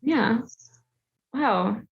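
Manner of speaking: ordinary talk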